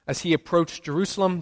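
ordinary delivery

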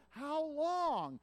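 high pleading cry. Acted. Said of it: "crying out to God".

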